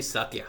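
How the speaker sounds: Satya said the Spanish way; Spanish-style Satya; Spanish pronunciation. Real, not acted. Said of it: "I pronounce her in Spanish".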